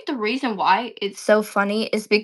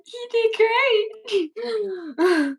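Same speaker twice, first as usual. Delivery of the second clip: very slow, much higher, fake-sobbing; mock crying praise; 11 semitones higher, much slower than usual. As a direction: drag it out in a hammed-up cry. Praising a friend's bad attempt.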